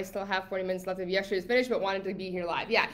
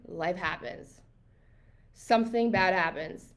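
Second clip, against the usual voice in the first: normal talking voice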